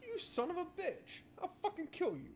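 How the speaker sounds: high-pitched hammed-up fury, laughing; mock outrage. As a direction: pretend to be furious, cracking up.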